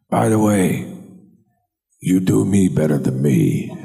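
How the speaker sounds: Pacino paying a compliment; Pacino impression. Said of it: "he just looked at me and he went".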